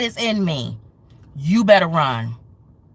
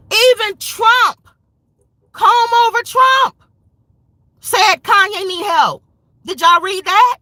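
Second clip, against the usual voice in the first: high, loud outraged yelling; outraged shout; 10 semitones higher, 4 dB louder than usual. Real, not acted. Vlogger to her viewers about Trump, high outraged shout. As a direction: shout it in disbelief.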